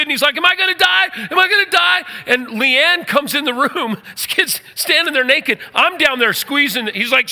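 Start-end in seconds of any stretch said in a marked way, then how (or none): 0.4-2.0 high, loud panicked question; retold panic, pitched high and loud; scared kid. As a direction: imitate a terrified kid begging to know. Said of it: "to this scared kid".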